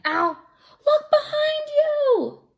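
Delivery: very high, loud, slow screamed warning; panicked princess scream. Acted. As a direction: shriek to save him. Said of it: "the princess screamed".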